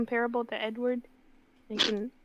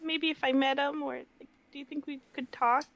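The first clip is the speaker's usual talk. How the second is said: ordinary talk, nothing marked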